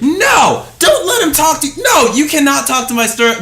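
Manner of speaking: high, loud protective shout; retold shout. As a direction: shout to defend your employee.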